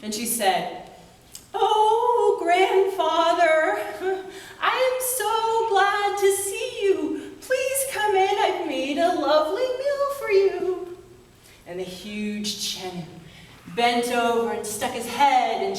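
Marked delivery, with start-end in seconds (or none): 1.5-11.0 put-on high, sing-song girl greeting, much higher; sweet granddaughter. Girl welcoming the huge grandfather, high and sing-song. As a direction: welcome him in a high lilting little-girl voice.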